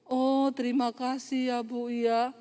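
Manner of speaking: softened, meek gratitude; soft, grateful thanks. Retold or imitated. Relieved woman thanking the preacher after crying, gently grateful. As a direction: imitate a humbled woman, gentle and drawn out.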